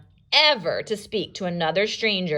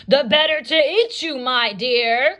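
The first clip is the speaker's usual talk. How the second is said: louder, higher menacing wolf cry; wolf cries; 6 semitones higher, 5 dB louder than usual. Acted. The wolf pounces on Red with a loud cry. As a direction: pounce on the words. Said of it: "cried the wolf".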